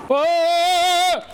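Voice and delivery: high loud panicked yell; real scream. Real, not acted. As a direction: scream at the killing blow. About to die in fight.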